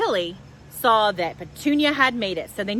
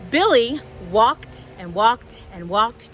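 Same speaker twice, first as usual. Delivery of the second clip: usual speaking voice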